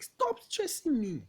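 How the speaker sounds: high, loud, slow yell of exasperation; exasperated outburst. Acted. Uncle finally answers after fifteen requests, exasperated yell. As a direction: snap after being pestered, voice shooting up. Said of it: "You've laid so much emphasis".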